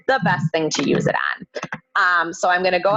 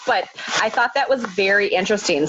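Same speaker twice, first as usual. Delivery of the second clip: normal talking voice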